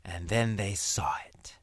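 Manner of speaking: soft, secret whisper; suspense whisper. Shown as a demonstration. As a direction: hush before the big reveal. Suspense before seeing Thailand.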